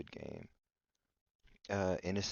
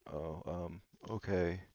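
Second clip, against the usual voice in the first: ordinary talk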